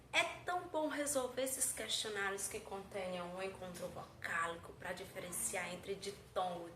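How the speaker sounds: low, softened pupil voice; pupil talking to teacher. Acted. Pupil telling teacher the topic is so good, low-key.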